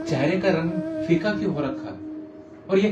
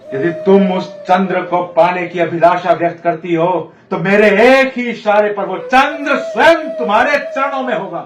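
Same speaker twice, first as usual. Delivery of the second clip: grand promise; 7 dB louder than usual. Acted. King promising the moon to his queen, grand promise. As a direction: proclaim it, swelling up in pitch.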